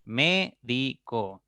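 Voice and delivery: "Médico" slowly as mé - di - co; syllable by syllable. Shown as a demonstration. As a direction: break "Médico" into three slow beats, mé, di, co. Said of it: "pueden descomponerse en tres sílabas".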